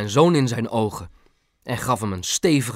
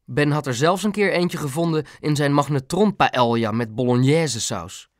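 ordinary delivery

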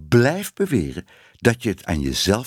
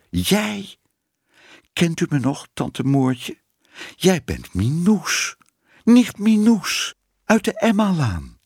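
surprised recognition; 9 semitones higher than usual. A narrator's reading. Startled old cat recognises niece.

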